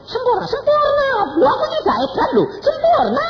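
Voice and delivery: very high, loud screams; hammed-up high-pitched outburst; ecstatic shrieking. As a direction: scream it like someone overcome. Acts mama shouting, thought crazy.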